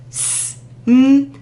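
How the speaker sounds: smoky chopped into each sound; teacher chopping the word; segmented sounds. Shown as a demonstration. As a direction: say each sound apart, slowly. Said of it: "chop it up".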